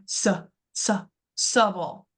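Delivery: "s, s, subble" as short separate suh, suh, suh-bull; isolated schwa syllable. Shown as a demonstration. Teacher isolating the schwa sound, "subble" said as suh-bull. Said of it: "that's how I know that S I".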